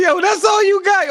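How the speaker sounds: high loud taunting shout; mock shout. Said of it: "You say".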